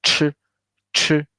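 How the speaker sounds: ch with a strong puff of air; aspirated ch. Shown as a demonstration. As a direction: push lots of air out on ch. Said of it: "a lot of air pump out".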